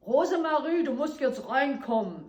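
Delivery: loud high call in German; mother yelling. Retold or imitated. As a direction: yell her in from down the hill. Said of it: "we could hear her mother yelling".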